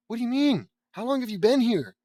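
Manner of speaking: high, loud startled questions; surprised. Acted. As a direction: jump at the interruption. Startled reaction to Eddie.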